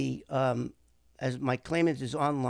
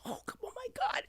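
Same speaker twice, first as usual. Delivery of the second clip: high, soft whispered gushing; breathy awe; 8 dB quieter than usual. Retold or imitated. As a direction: mimic her breathless disbelief in a whisper. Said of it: "she gets all breathy with me".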